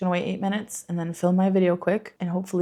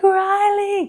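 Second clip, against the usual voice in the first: retold shout, much higher and louder; calling out; 14 semitones higher, 7 dB louder than usual. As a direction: yell the kid's name across the street. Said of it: "and I was like".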